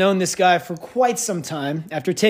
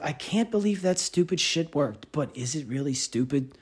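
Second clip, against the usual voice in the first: dumbfounded mutter; 3 semitones lower, 7 dB quieter than usual. Retold or imitated. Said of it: "dumbfounded face of".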